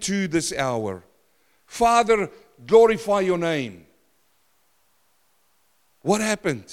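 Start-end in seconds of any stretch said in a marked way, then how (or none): none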